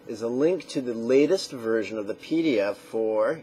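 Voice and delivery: usual speaking voice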